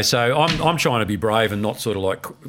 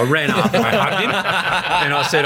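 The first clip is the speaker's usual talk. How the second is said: words broken by real laughter; laughing; 8 semitones higher, slower than usual. Real, not acted. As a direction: laugh through the telling. Laughing while telling.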